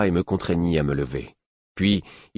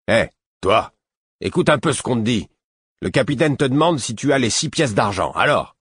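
higher, louder gruff demand; rough guard; 6 semitones higher, 4 dB louder than usual. A narrator's reading. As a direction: shake him into answering. Guard shaking prisoner. (garde rude qui hausse le ton)

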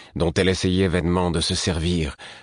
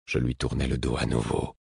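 plain reading voice

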